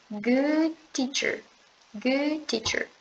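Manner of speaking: "Good teacher" with the D dropped into the T, slow; linked good teacher. Shown as a demonstration. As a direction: run "good" straight into "teacher", no D. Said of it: "como se você cortasse esse D e só falasse o T". ("Good teacher" com o D cortado, junto do T)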